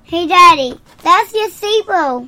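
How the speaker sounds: high, loud excited call; excited shout. Real, not acted. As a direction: call out to Daddy, thrilled. Kid calls out excitedly.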